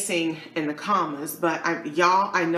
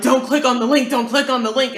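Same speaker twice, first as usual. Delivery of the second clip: loud, higher-pitched repeated warning; mock urgent warning; 6 semitones higher, 7 dB louder, faster than usual. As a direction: blurt it out like alarmed friends. Friends' frantic warning acted out.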